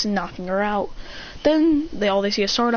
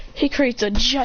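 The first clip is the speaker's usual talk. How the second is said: plain reading voice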